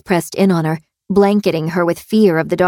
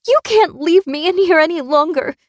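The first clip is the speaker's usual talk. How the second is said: high, desperate captive voice; desperate plea; 11 semitones higher than usual. A narrator's reading. Captive pleads at the locked door, desperate.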